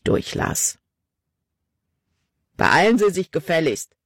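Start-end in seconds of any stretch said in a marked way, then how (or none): 2.6-4.0 curt, impatient demand; a hint of an annoyed official; impatient complaint. A narrator's reading. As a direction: hurry him up with clipped irritation. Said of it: "beschwerte sich Riggs".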